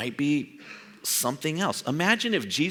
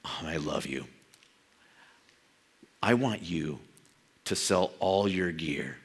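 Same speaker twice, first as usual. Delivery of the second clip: tender Jesus voice; 7 semitones lower than usual. Pretend. Preacher voicing Jesus to a hunter, tender and low. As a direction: lovingly ask them to let go.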